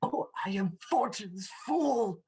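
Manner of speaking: high, slow, drawn-out lament; anguished despair. Acted. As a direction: cry out in despair without shouting. Romeo despairs after killing Tybalt.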